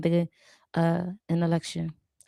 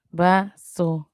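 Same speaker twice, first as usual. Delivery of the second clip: usual speaking voice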